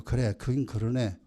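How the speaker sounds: low, soft, resigned parent; conceding parent. Retold or imitated. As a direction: sigh it out low like a parent admitting fault.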